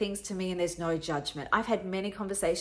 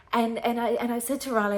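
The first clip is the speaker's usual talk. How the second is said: ordinary talk, nothing marked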